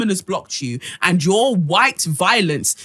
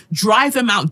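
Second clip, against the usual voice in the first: ordinary talk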